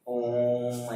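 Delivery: ओम chanted slowly, held long; drawn-out Om. Shown as a demonstration. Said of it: "इसको पढ़ने में बहुत समय लगता है".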